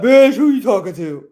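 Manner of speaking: loud mock sass with a laugh; cheeky pet clapping back; sassy dog voice. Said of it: "She like".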